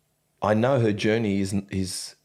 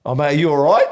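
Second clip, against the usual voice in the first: loud, higher, overly cheerful call; mock friendly greeting; 4 semitones higher, 9 dB louder, faster than usual. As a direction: boom it out friendly to throw him off. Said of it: "I rock up, I go".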